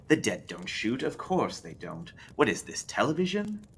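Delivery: lightly voiced sly spindly man, scoffing; a hint of a smug know-it-all; spindly man scoffing at Lou, lightly voiced; spindly man mocking.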